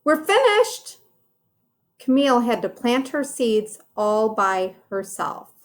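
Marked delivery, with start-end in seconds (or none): 0.0-1.0 put-on very high little girl voice; high childlike announcement; Frida announcing. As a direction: squeak it out like a proud kid.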